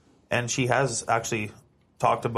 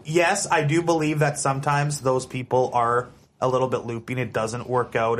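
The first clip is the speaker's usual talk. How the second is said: normal talking voice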